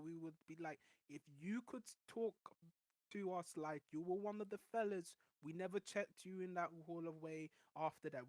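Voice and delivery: normal talking voice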